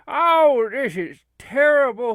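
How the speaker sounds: loud, high mock lament; hammed-up dismay. Friend left without a hunting partner, wailing in mock dismay. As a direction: wail it like a comic tragedy. Acted lament over losing Billy.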